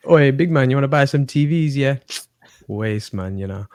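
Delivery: London roadman hawking goods; put-on roadman accent, loud opening then dropping off. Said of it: "You are a roadman trying to sell".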